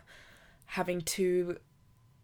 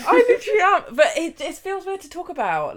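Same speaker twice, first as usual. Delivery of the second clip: choked up, voice rising and louder; fighting back tears; touched; 12 semitones higher, 10 dB louder, faster than usual. Real, not acted. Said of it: "oh my God, I'm gonna cry".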